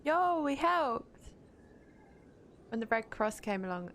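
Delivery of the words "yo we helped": high, drawn-out celebration; excited cheer. Real, not acted. Gamer celebrating helping out in a fight, excited cheer. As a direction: cheer it, stretching out the words.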